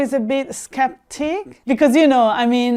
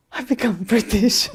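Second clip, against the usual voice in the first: mock shock. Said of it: "I'm shocked".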